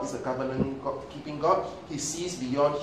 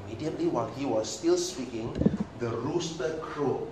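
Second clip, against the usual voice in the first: normal talking voice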